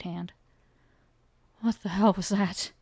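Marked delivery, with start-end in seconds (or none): 1.6-2.7 hoarse, shaken question. Acted. Soren after fighting the creature, hoarse shaken voice. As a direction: rasp it out in shock. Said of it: "Soren's voice was hoarse".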